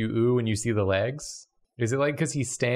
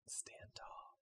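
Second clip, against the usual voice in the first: whisper; 31 dB quieter than usual. Acted. Spooky whisper.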